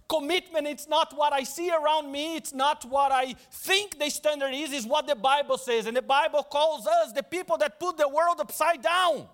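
usual speaking voice

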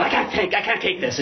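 a touch of an overwhelmed wife; imitating wife. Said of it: "She goes".

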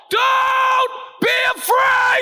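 very high, drawn-out shout; full-force yell to be heard at a distance; yelling over storm. Acted. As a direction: holler it through wind and waves. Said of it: "has to yell".

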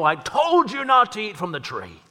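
a pretend furious God, lightly voiced; mock divine rebuke. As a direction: boom out a mock scolding. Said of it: "and go".

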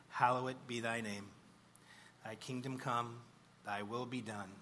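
ordinary delivery

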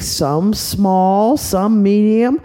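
ordinary delivery

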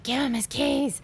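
high mock shriek without full volume; high-pitched, held-back mock scream; screaming mom, imitated. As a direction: imitate a terrible mom screeching at her kid. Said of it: "is screaming at Lizzie like a terrible mom".

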